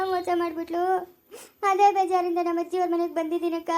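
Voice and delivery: hammed-up crying over a betrayal; acted sobbing lament; tearful wailing. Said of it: "ಅಯ್ಯೋ, ಅಳ್ಬೇಡ".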